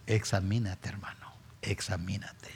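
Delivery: whispered solemn plea. Acted. Preacher whispering to each listener to examine himself. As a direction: breathe it to the brother.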